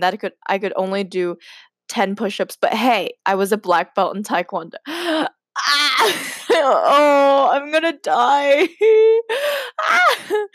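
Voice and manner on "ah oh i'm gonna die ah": high, laughing shrieks of embarrassment; giggly mortified screeching; embarrassed laughing squeal. Real, not acted. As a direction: squeal and laugh through the cringe. Reacting to the cringe story.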